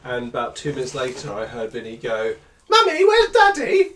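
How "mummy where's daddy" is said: put-on high little-boy voice, drawn out; imitating his toddler calling for daddy; child's voice. Father imitating his small son calling through the house.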